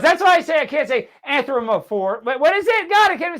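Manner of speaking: triumphant shout at remembering the word; excited outburst. Real, not acted. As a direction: yell it like you finally got it. Finally remembers the word Animorphs.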